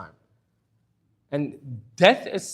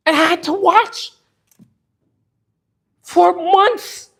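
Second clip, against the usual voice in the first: anguished outcry; 7 semitones higher, 5 dB louder than usual. Real, not acted. Father protesting to God over his sick wife, loud anguished outcry. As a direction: cry out the injustice, voice raised and strained.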